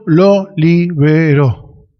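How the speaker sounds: "liberó" drawn out slowly, syllable by syllable; emphatic syllable-by-syllable. Real, not acted. Preacher driving home God's rescue, "liberó" slowly broken into syllables. Preacher drums in the key word.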